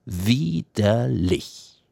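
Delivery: drawn-out, slow mock cry of disgust; mother's disgusted cry. A narrator's reading. Mother reviling the goatherd, in drawn-out disgust. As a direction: stretch the word out in slow revulsion. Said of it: "schrie jetzt die Mutter".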